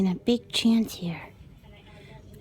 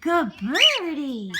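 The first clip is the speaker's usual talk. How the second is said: praising pet voice; 7 semitones higher, 4 dB louder, slower than usual. Real, not acted. Praising bird.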